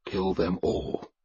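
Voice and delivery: quiet, slow prayer; a slow, softly spoken plea to a god. Acted. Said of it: "I prayed to Thor".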